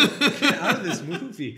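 loud, drawn-out, laughing shout; slow mock yell through laughter; mock outburst. Reviewer to film characters, loud mock outburst.

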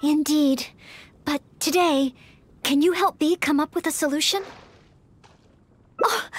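ordinary talk

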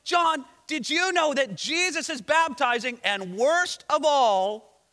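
hammed-up complaint to their master; alarmed outcry. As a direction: act out upset disciples crying out. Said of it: "They cry out to John".

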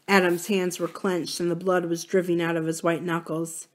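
ordinary delivery

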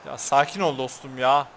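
normal talking voice

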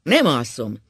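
loud, defiant refusal; a loud, cocky refusal. A narrator's reading. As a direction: refuse stubbornly and loudly. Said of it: "mondta Ákos hepciásan".

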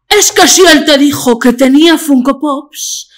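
Ana Milán impression. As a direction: lecture the caller in a faked sultry voice. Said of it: "qué bien lo hago".

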